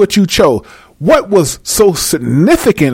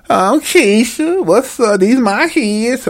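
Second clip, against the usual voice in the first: higher, slow woman voice; 5 semitones higher, slower than usual. Acted. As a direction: drag it out like a bored woman. Woman character introduces herself.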